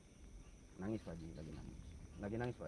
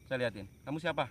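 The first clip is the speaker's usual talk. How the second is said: higher and louder, demanding; loud questioning; 4 semitones higher, 6 dB louder than usual. Real, not acted. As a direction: call out to the spirit to answer. Questioning a spirit at a tree. (bertanya lantang dan tinggi)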